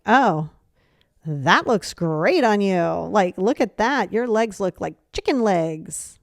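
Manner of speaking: slow, drawn-out mock admiration; sarcastic. Shown as a demonstration. Friend teasing someone about a new dress, sarcastic. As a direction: stretch the praise out sarcastically. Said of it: "sarcasm would more or less be like".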